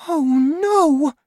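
put-on very high, loud girl's cry; distressed cry. A narrator's reading. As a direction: cry out high in alarm, as the girl. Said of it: "she cried".

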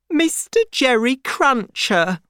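high-pitched, tearful answer; through tears. A narrator's reading. Miss Pross introduces Jerry to her unkind brother, tearfully. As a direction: say it high, as if upset and weeping. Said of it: "said Miss Pross through her tears".

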